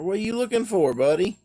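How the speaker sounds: loud high call to the dog. Real, not acted. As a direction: shout across the yard. Calling out to dog.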